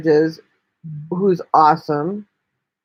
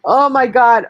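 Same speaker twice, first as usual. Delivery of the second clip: sudden loud oh my God, pitched up; startled and loud; surprised exclamation; 5 semitones higher, 6 dB louder than usual. Real, not acted. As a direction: blurt it out, caught off guard. Sudden exclamation at someone arriving.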